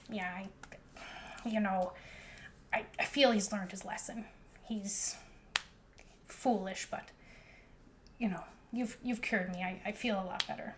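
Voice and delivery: forgive him quietly, with long hesitations; sighing. Acted. Old Hazel conceding he has learned his lesson, soft sighing voice. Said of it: "kind of sighs and she says".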